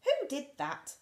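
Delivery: high, loud indignant squirrel; cross squirrel woken by a bump. Acted. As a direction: snap the question crossly. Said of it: "said the squirrel crossly".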